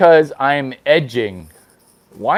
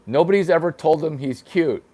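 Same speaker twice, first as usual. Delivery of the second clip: ordinary talk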